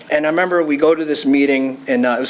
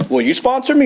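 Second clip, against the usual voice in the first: higher, eager plea; eager request; 9 semitones higher than usual. Retold or imitated. Newcomer eagerly asking the old-timer to sponsor him. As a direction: ask it hopefully, lifted up.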